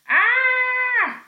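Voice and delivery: very high, loud scream; boy screaming on the banister. Acted. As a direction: let out a piercing scream. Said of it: "screamed Tom".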